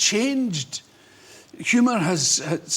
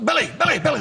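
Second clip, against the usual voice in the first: high-pitched, quick repeated call; calling after someone; 9 semitones higher, 3 dB louder, much faster than usual. Retold or imitated. Old man chasing the comedian along the beach, calling out. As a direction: call out from behind to get his attention. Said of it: "I hear a guy running behind me".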